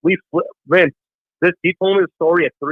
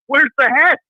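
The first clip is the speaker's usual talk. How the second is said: eager question; 8 semitones higher than usual. Retold or imitated. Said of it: "so I go".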